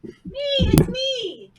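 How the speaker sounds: eager. Real, not acted. Eager self-nomination.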